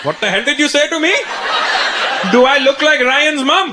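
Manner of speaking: high, rising disbelief as an affronted father; outraged dad. Retold or imitated. Dad challenges his son for swearing at him, outraged and high-pitched. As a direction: play dad, incredulous, pitch climbing. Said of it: "my dad goes".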